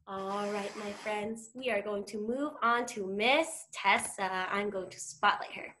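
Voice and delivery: ordinary talk, nothing marked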